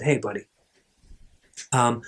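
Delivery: put-on low, hushed approach to a child; softly getting a kid's attention; gentle with child. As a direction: drop your voice to address a little boy. Kneels to correct a small child gently.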